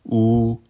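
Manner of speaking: OU held high and perfectly level; first tone on OU. Shown as a demonstration. Teacher showing learners Mandarin OU, high level tone. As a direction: say OU long, high and even. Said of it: "Une dernière fois".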